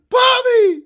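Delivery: very high, loud scream of a name; panicked shout. Retold or imitated. Kid hearing his trapped friend scream, panicked shout.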